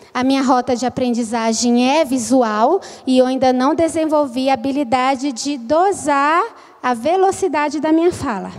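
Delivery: ordinary talk, nothing marked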